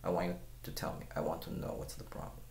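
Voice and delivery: ordinary talk, nothing marked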